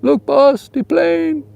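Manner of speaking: mock Fantasy Island sidekick calling the boss; Fantasy Island impression. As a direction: shout it like Tattoo spotting the plane. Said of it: "remind you of Fantasy Island".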